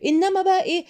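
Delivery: ordinary talk, nothing marked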